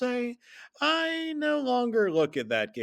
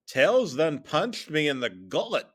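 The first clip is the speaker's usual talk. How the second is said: usual speaking voice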